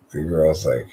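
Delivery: usual speaking voice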